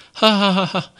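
a high playful laugh, teasing; light laugh. Acted. Suyu laughing at the robber, a high playful laugh. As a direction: laugh at him like he's a country bumpkin.

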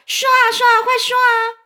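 very high, loud clamour; frantic high-pitched demand; frenzied shouting. A narrator's reading. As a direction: yell like a crowd gone mad. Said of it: "大家发狂似的叫喊说".

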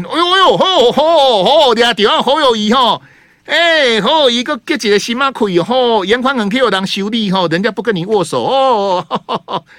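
high, loud, gossipy auntie exclaiming; gossiping TV viewer. Retold or imitated. Host mocking a Sanli viewer thrilled by Hou snubbing Yan, high loud gossip. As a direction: squeal it like a nosy neighbour lapping up scandal.

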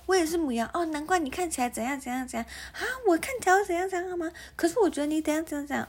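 high bubbly zodiac gossip; fake enthusiasm. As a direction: gush over star signs. Mocks zodiac small talk.

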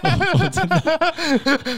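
high laughing, words stretched out. Real, not acted. Laughing at the mixup.

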